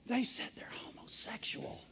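whispered gossip, as if unsayable; hushed scandalised whisper. Acted. Preacher mimics a church member passing on scandal, hushed whisper. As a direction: drop to a whisper for the shocking word.

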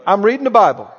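normal talking voice

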